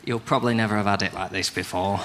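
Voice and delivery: low, subdued bedroom boast; unconfident lover. Acted. Awkward man admitting his poor bedroom skills, low and subdued. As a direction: say the boast small and apologetic. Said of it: "but it's more of a".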